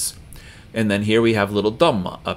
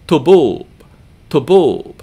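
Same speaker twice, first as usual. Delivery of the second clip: Tuboob with a quick tu and loud, held boob; stress on second syllable; 5 semitones higher, 7 dB louder than usual. Shown as a demonstration. Teacher having learners learn it right, tuboob stressed on the second syllable. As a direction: punch and stretch "boob" in "tuboob". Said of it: "second syllable gets more volume and duration".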